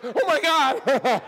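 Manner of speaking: loud, slow, hammed-up alarm; mock scared. Husband retelling fear of his towering wife, mock scared. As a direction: cry out in comic fright. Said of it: "And I was scared".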